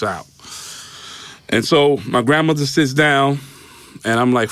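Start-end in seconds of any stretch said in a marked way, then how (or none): none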